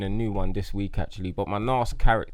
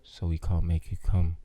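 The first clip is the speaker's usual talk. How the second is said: love doctor voice; 3 semitones lower than usual. Pretend. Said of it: "It's gonna be a love doctor".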